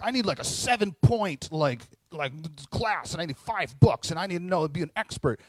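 mock overthinker. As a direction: ham up the over-prepared know-it-all. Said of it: "in my mind, I'm like".

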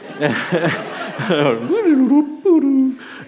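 high-pitched real giggle. Real, not acted. Preacher laughing at a remembered joke, high giggle. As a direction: let a high giggle escape.

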